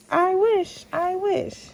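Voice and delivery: mother showing her daughter the line; high princess wish. Shown as a demonstration. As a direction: lift the words high like a princess.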